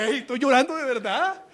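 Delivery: high, loud child's protest, acted; mock indignant retort. Preacher as the spanked child answering the aunt, loud mock protest. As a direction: protest loudly, as if wronged. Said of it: "dan ganas de decirle".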